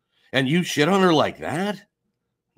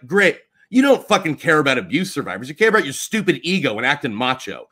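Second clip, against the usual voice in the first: ordinary talk, nothing marked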